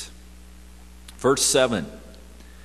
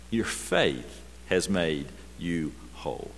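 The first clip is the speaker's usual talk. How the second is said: gentle quote; 6 semitones lower, 6 dB quieter than usual. Acted. Jesus's words voiced gently.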